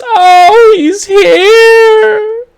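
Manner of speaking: very high, loud panicked cry; terrified wail. Acted. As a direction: wail it out in terror, stretching the last word. Terrified mother points at the monster.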